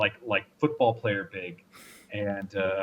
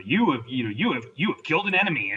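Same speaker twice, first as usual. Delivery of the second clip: lightly imitated video game announcer; 4 semitones higher than usual. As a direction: announce the kill with lifted, swooping pitch. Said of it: "they've got an announcer in the background".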